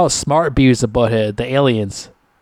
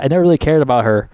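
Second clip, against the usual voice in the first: ordinary delivery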